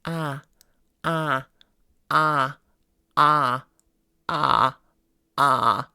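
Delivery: repeated "ah", building from light to thick and loud, ending in fry; thickening vocal weight into fry; progressively thickened ah. Shown as a demonstration. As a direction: thicken each "ah" until it creaks. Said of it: "thicken it up and thicken it up".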